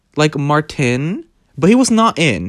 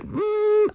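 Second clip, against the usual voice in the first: high evasive hum; 6 dB quieter than usual. Retold or imitated.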